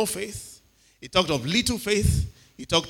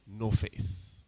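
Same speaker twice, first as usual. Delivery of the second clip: hushed rebuke; 8 semitones lower, 8 dB quieter than usual. Acted. Quiet rhetorical rebuke.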